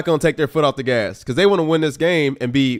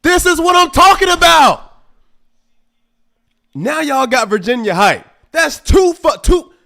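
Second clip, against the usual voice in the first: high, loud frustrated rant; 10 semitones higher, 5 dB louder than usual. Real, not acted. Fan ranting at the screen about the turnovers, loud. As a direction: rail at the team.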